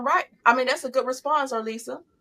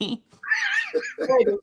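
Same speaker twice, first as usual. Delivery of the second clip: high drawn-out call; 4 dB quieter than usual. Pretend.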